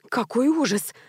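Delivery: hushed, mildly shocked exclamation; understated horror; horrified gasp. A narrator's reading. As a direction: gasp it softly in dismay. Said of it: "ахнула Вера Кирилловна".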